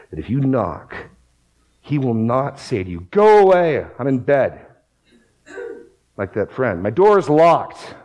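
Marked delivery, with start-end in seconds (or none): 3.1-4.6 loud, high shout to go away, then easing; acted refusal. Friend in bed turning away a caller, acted shout. 6.8-8.0 raised, higher voice, drawn out; acted refusal. Friend refusing to open the door, loud acted refusal.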